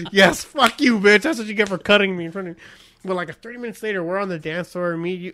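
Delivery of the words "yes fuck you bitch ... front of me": loud, raised mock triumph; gloating retold outburst. Man who got cut in line gloating, loud mock triumph. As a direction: relish the payback, starting loud and easing off. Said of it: "I'm trying like".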